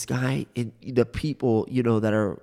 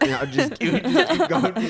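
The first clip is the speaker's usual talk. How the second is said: laughing. Real, not acted. Laughing through the joke.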